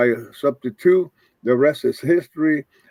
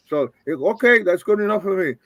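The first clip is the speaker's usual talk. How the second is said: usual speaking voice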